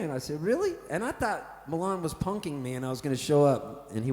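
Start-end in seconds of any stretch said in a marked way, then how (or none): 0.4-0.7 high, disbelieving "Really?"; surprised. Retold or imitated. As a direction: pop the word up in disbelief. Surprised at the Paul Kelly news.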